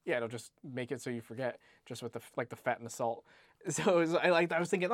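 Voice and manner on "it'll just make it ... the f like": normal talking voice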